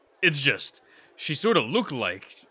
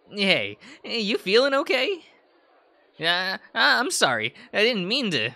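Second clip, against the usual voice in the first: lifted, higher-pitched voice; higher character voice; 9 semitones higher, 4 dB louder than usual. Acted. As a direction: play a higher-voiced friend.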